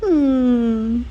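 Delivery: ordinary delivery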